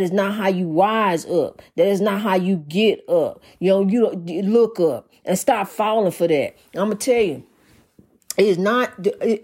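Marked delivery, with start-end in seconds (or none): none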